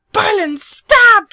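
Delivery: high, drawn-out yell to stop; alarmed shout. Acted. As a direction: shout the warning at the top of your voice. Vaxia cries out to stop him.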